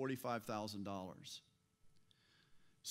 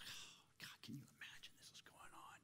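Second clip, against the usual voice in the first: hushed, scandalized aside; indignant whisper, acted; 20 dB quieter than usual. Preacher playing the men whispering to each other, indignant whisper. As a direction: whisper it like a disgusted onlooker to a neighbor. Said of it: "looking at each other indignantly".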